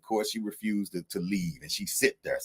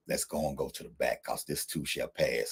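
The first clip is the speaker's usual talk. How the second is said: tender reassurance; 3 semitones lower, 8 dB quieter than usual. Retold or imitated. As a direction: soothe a hurting kid beside you. Calming a crying girl.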